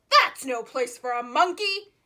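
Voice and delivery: loud scolding lifeguard voice; scolding yell. Acted. Lifeguard shouting George out of his special chair, loud scolding. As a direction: tell the monkey off, loud. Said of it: "he yelled".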